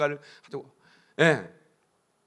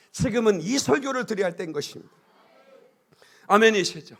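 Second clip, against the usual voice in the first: usual speaking voice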